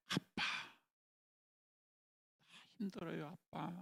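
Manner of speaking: hushed prayer whisper; quiet pleading prayer. Acted. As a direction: breathe it out like a tired child praying. Praying child to father God.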